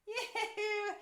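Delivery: high loud yahoo while stretching; excited whoop. Pretend. Stretching game with kids.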